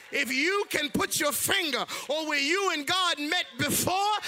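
sung, shouted whoop over the organ; preaching at full force. Real, not acted.

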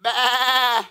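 loud, high bleating bah; hammed-up lamb bleat.

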